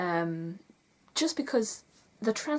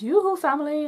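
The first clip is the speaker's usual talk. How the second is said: higher, louder sing-song greeting; Oaken's call; 8 semitones higher, 8 dB louder than usual. Retold or imitated. Oaken calling to his family in the sauna, high and loud. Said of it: "and then he goes".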